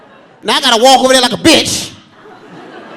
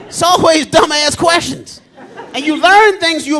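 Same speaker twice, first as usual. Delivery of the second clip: usual speaking voice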